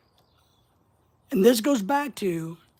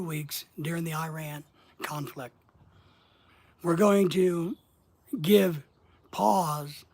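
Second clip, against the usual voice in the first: slow with pauses. Shown as a demonstration. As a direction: give pause and a thought, slowly. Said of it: "Or you can speed it up".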